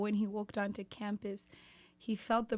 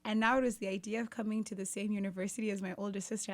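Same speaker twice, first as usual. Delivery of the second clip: ordinary talk, nothing marked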